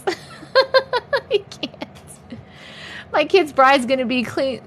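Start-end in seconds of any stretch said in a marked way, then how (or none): 0.0-1.8 breaking into real laughter, much higher; laughing. Real, not acted. Laughing at her own son-in-law slip, giggling it out. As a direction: squeak it out through a laugh.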